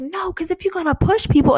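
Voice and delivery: mild imitated telling-off; retold yelling. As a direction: imitate someone yelling an accusation, only half as loud. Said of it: "yelling at them".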